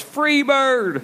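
high shouted call from the back; yelled request. Retold or imitated. Heckler in the back yelling a song request, high shout. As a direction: holler it from the back row. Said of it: "somebody in the back yells".

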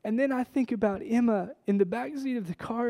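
normal talking voice

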